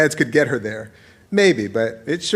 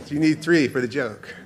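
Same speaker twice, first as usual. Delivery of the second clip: ordinary talk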